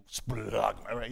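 "Splagma" low and soft, like retching; "Splagma" as a guttural gag; retching pronunciation. Shown as a demonstration. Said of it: "doesn't sound like you're retching".

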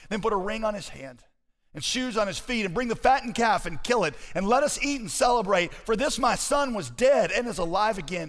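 high, rising proclamation; jubilant scripture preaching. Acted. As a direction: build it up like a joyful father's command. Reads the father's jubilant order.

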